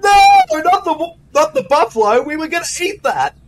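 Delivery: very high, loud mock Michael Caine; squeaky, panicked Cockney film star; Michael Caine impression. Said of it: "Is that you, Michael Shane?".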